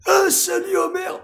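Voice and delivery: very high, slow cartoon drunk shout; Barney. Cartoon friend greeting Homer, very high shout. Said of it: "Barney dans Les Simpsons".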